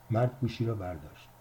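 usual speaking voice

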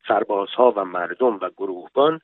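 plain reading voice

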